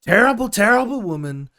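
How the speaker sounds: hammed-up Trump snarl; mock Trump. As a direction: ham up a disgusted Trump. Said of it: "he was sitting there going".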